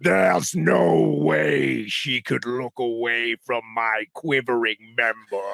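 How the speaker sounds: slow, booming mock-demon narration; Pinhead. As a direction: read erotica as a horror villain. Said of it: "Pinhead from Hellraiser".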